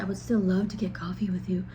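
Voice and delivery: mock-creepy, slightly hushed; half-hearted creepy suitor impression. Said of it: "his creepy voice when he said".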